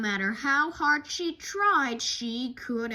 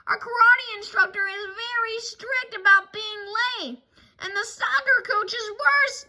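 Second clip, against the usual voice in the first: put-on higher child voice, loud and anxious; yelled in a child voice; 11 semitones higher, 8 dB louder than usual. Sister worrying about being late, high loud child's yell. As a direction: yell it as a worried little cub. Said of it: "yelled Sister".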